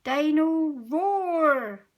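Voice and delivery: put-on a toddler thrilled by his new toy; stretched-out delighted "Dino Roar"; excited. A narrator's reading. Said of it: "says George excitedly".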